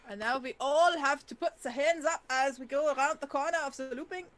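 loud, hammed-up tour guide announcement. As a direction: call it out to the group like a tour guide. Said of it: "I'm going back to tour guide".

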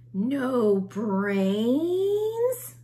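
loud, groaning zombie crowd asking; puzzled zombie chorus; zombie voices. A narrator's reading. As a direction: call it out like hungry zombies.

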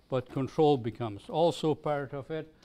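ordinary talk

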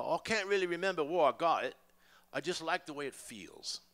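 put-on light English accent, casual and offhand; a breezy English shrug of an answer. Paul answering about the t-shirt, light English accent.

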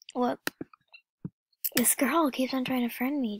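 ordinary delivery

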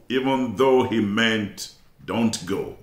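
ordinary talk

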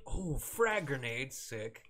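ordinary talk, nothing marked

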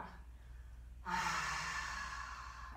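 soft drawn-out breathy exhale; long exhaled breath. Shown as a demonstration. Teacher shows the fire breath trailing off, soft exhale. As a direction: let all the air out slowly. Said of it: "a long ha sound".

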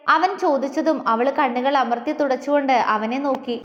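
ordinary delivery, nothing marked

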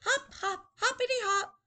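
high, bouncy hopping chant; playful little boy. Acted. Teacher voices boy hopping in puddles, high and playful. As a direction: bounce on each hop.

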